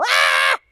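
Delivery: short mock scream of fright; imitated scream. Man recalls snake jumping at him, screaming. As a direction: yelp as if something jumped at you. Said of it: "I screamed".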